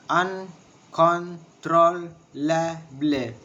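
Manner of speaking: "Uncontrollable" drawn out in separate syllables; very slow, a gap after each syllable. Shown as a demonstration. Teacher showing the syllables of uncontrollable, syllable by syllable. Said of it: "six syllables".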